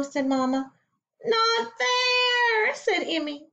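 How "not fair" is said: high, whiny complaint; whiny protest. Acted. Emmy protesting to Mama, high whine. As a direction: whine it like a let-down child.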